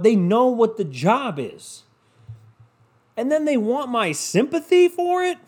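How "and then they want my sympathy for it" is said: high-pitched, disbelieving rant; incredulous. Real, not acted. Podcaster fed up with calls for sympathy, incredulous.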